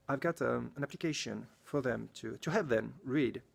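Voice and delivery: ordinary delivery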